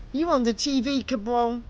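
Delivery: woman defying the thug; shot back. A narrator's reading. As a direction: snap it right back. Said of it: "she shot back".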